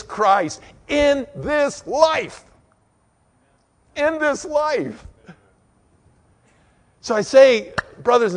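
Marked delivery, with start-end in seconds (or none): 0.0-2.4 drawn out, stretched slowly; slow emphasis. Real, not acted. Preacher to congregation, slow and drawn out. 3.9-5.1 higher, slow repeat; slow echo. Real, not acted. Preacher echoing his point, slow and high.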